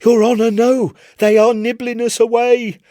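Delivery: high, loud desperate pleading; pleading sailor. Acted. Chips on his knees begging the Admiral, high and urgent. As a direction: beg for everyone's lives in a high strained voice.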